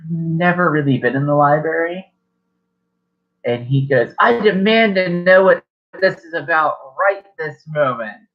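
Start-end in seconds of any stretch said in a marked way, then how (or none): 4.2-8.3 raised, higher angry demand; yelling demand. Acted. Father demanding answers from his eavesdropping son, yelling. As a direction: demand answers sharply, voice pushed up. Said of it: "your cousin yells out".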